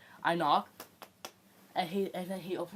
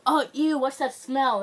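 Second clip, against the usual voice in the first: a loud, high "ew" of disgust; grossed-out yelp at the smell; disgusted surprise; 6 semitones higher, 8 dB louder, slower than usual. Retold or imitated. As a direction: recoil at the stink out loud. Walking into homeroom, smelling the stink.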